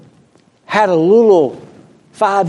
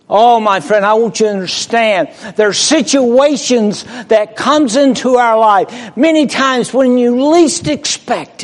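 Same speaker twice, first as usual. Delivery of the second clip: raised, louder preaching push; fervent preaching; 9 semitones higher, 4 dB louder than usual. Acted. Preacher urges congregation, raised fervent voice. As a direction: drive the point home to the church.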